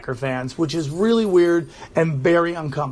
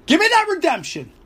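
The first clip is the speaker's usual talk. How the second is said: mock pleading; 11 semitones higher than usual. Mock plea for redemption.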